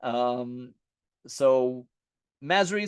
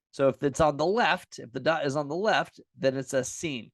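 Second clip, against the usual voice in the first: ordinary talk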